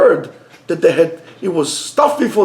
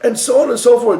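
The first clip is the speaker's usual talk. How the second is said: ordinary talk, nothing marked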